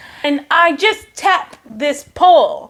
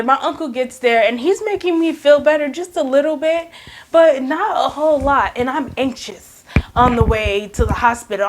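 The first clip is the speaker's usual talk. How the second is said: plain reading voice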